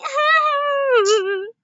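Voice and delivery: loud high burst of laughter; cracking up at the game; laughing. Real, not acted. Player cracking up at her own blunder, loud laugh.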